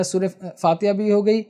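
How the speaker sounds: ordinary talk, nothing marked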